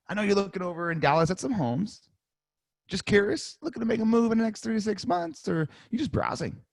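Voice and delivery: pause, then ask it casually; pause then curious tone. Shown as a demonstration. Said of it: "Quick pause".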